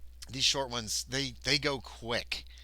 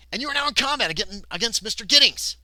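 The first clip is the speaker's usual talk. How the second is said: high, loud, rushed excitement; rising, urgent proclamation; combat announcement; 9 semitones higher, 10 dB louder than usual. A narrator's reading. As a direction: burst into it like a fight announcer.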